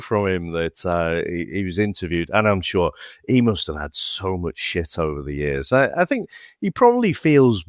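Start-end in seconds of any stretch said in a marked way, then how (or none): none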